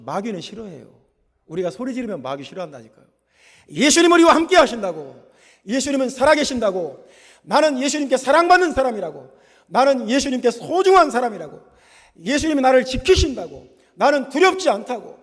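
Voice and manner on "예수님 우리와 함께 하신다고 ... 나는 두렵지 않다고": high, loud volley of faith declarations; shouted declaration. Shown as a demonstration. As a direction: proclaim each line forcefully, voice held high. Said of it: "우리가 소리를 지르고 나가면". (소리 높여 선포하며)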